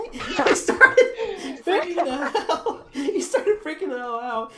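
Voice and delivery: high, loud words squeezed through laughter; cracking up while trying to talk; helpless laughter. Real, not acted. Teller recalling the boy's panic, real helpless laughter.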